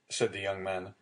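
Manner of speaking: ordinary delivery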